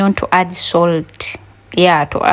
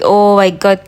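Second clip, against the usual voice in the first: normal talking voice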